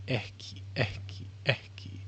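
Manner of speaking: unvoiced whisper of the word; whispered practice. Real, not acted. Learner whispering the word to practise it, whispered. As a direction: mouth ekki breathily to himself.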